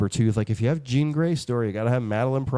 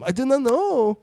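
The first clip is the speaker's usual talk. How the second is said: much higher, louder mock Scottish "I didn't know"; Scottish accent; 13 semitones higher, 4 dB louder, slower than usual. Host retelling her request for a Scottish accent. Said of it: "do a Scottish accent".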